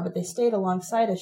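plain reading voice

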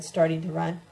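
ordinary delivery

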